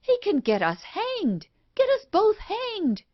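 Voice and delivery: panicked. Acted. Wife panicking that they'll be hanged. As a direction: voice climbing high in panic.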